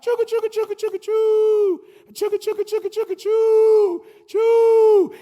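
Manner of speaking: high chugga chugga choo train noise; train chugging. Pretend. Speaker to graduates, high train sound effect. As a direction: chug like a little engine up a hill.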